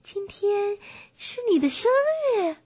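high-pitched, astonished bear voice; surprised. Acted. Bear learns it is the donkey's birthday, surprised. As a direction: ask in wide-eyed surprise, pitched well up. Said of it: "小普惊讶地问道".

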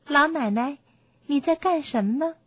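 put-on high-pitched, inquisitive young princess; curious princess. A narrator's reading. Princess asking the old woman at her spindle, curious. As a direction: ask with innocent curiosity, voice lifted high. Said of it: "公主很好奇，就上前问".

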